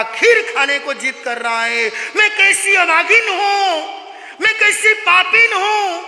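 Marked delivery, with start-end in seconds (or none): 2.2-6.0 ऊँची आवाज़ में विलाप करते हुए; बेबसी में रोते हुए खुद को कोसना; रोती हुई माँ का विलाप. Acted. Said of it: "जोर जोर से रोने लगी". (बेटे को खीर न खिला पाने पर रोती माँ का विलाप)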